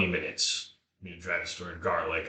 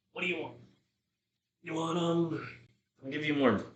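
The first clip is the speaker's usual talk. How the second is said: higher, softer doting talk; pet voice; 7 semitones higher, 4 dB quieter than usual. Real, not acted. Offering his dog pepper, higher doting pet voice. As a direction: tempt the pup with a treat.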